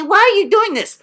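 high, whiny mock question; mocking quote. Coach mocking another student's question. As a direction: parrot his lame question mockingly.

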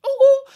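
loud high cooing ooh; a loud high-pitched ooh, ooh; ooh noise. Shown as a demonstration. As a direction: coo at the comic. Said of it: "can you just be like".

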